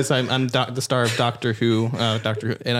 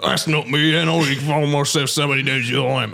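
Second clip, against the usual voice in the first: garbled mock Cockney protest; Benedict Cumberbatch. Telling the bus driver he's wrong, fake Cockney. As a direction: protest in a bad London accent. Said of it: "so I'm like".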